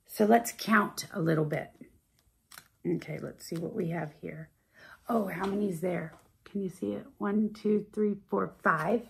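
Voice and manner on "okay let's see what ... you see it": soft whisper; hushed counting whisper. Acted. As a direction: whisper while searching the page.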